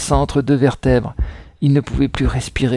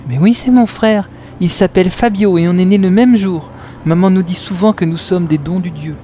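put-on higher young boy's voice; child voice; 5 semitones higher than usual. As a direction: answer like an eager little boy. Boy answers, child voice.

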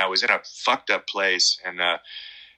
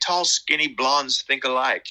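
higher, put-on [name] voice, joking; [name] imitation; 6 semitones higher than usual. [name] joking with his guitarist, high imitated voice. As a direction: deliver [name]'s quip with a grin.

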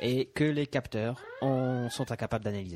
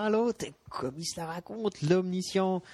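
mocking; 7 semitones higher, slower than usual. Mocking the alien's title.

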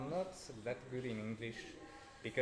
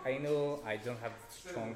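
ordinary talk, nothing marked

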